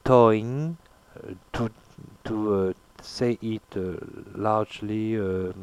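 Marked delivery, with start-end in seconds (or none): 0.0-0.8 toing with a nasalized vowel, no hard ng; nasal toing. Shown as a demonstration. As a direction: say toing through the nose.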